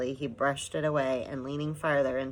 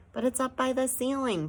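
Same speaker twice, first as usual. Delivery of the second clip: weary explaining; 10 semitones higher than usual. A narrator's reading. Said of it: "Pod explained wearily".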